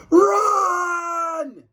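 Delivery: high, loud panicked shout; cried Run. Acted. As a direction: scream it, run for your life. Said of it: "cried George".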